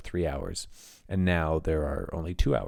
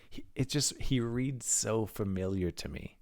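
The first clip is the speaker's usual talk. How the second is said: ordinary talk, nothing marked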